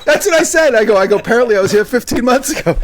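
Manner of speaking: high-pitched, loud, amused retelling; laughter pushing the voice up; laughing. Real, not acted. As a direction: crack up as you repeat yourself. Cracking up over own remark.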